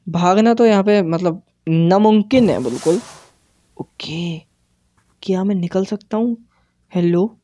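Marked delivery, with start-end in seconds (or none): none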